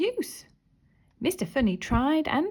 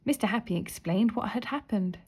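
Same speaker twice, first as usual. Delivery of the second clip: usual speaking voice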